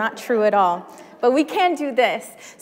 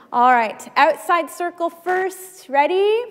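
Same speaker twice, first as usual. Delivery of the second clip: calling out; 6 semitones higher, 3 dB louder than usual. Real, not acted. Cueing the congregation.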